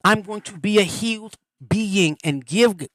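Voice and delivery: ordinary talk, nothing marked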